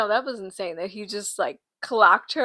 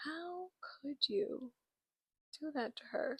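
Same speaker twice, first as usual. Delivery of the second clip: hurt disbelief; 3 semitones higher, 16 dB quieter than usual. Retold or imitated. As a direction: ask it like you feel betrayed. Betrayed by character.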